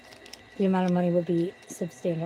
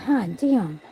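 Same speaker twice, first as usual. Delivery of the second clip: usual speaking voice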